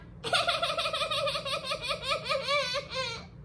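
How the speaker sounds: put-on high, broken little baby cries; mock baby sobbing; imitated baby sobs. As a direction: sob in short bursts like a baby. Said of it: "the lots of the little sounds".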